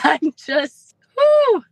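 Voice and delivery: high, breathless "whoo" with a laugh; overwhelmed whoo. Real, not acted. Mother hearing her son changed, bursting out high. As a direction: let the joy burst out high.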